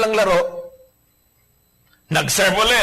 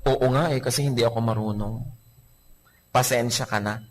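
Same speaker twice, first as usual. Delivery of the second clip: gentle answer; 5 semitones lower, 5 dB quieter than usual. Acted. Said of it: "Gentle answer turns away wrath".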